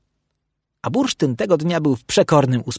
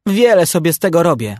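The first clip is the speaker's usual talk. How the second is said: louder, higher cheeky comeback; grumbled retort; 4 semitones higher, 6 dB louder than usual. A narrator's reading. Young Bursztyn defies Puc's telling-off, grumbled retort. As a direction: snap back dismissively. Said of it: "odburknął Bursztynsio".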